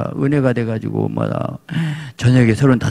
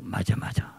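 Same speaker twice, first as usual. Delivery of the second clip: soft unvoiced murmur of agreement; whispered agreement; 3 semitones lower, 10 dB quieter than usual. Wife whispering to soothe her husband. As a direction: hush it like soothing an angry husband.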